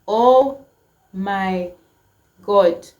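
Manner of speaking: loud, stunned oh my God; sudden astonished exclamation; shocked gasp. Retold or imitated. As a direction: blurt it out in disbelief. Stranger finally replies, shocked.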